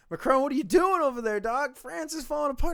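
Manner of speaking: high, loud mock Trump rant; a hammed-up Trump needling Macron. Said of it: "it's like".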